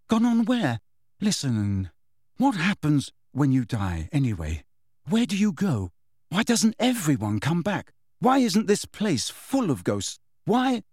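high, insistent questioning; agitated young character voice; demanding boy Harry. Acted. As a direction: fire questions, climbing to a high final demand.

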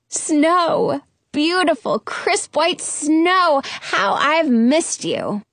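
high, slow, overjoyed exclamations; delighted. Acted. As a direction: savor every word with joy. Delighted to find snow.

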